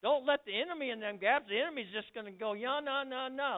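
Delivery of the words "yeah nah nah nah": higher-pitched mock yammering; mocking chatter. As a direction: mimic the enemy's nagging babble. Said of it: "like that".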